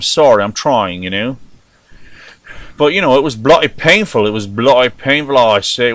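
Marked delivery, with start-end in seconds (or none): 2.8-4.3 mock Cockney accent on bloody painful; an overdone Cockney accent, dropping the t sounds. American host jokingly trying a Cockney accent for British friends. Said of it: "What is that, like the Cockney style?". 4.3-5.9 mock Cockney on bloody painful, I say; a hammed-up Cockney accent, tacking on I say. American host jokingly trying a Cockney accent for British friends. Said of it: "What is that, like the Cockney style?".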